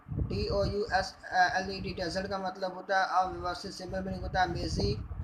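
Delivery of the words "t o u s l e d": slow, each letter said apart; spelled out letter by letter. Shown as a demonstration. Teacher spelling tousled for students, slow and letter by letter. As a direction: spell it out slowly for the class.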